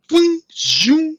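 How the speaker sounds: spring the droid to life with a zippy boing; boing zoom effect. Retold or imitated. Droid powering up and flying.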